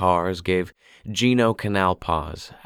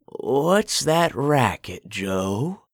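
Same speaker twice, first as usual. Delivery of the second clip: put-on slow, higher old woman voice; an old woman calls to her husband; old woman calling; 6 semitones higher, much slower than usual. A narrator's reading.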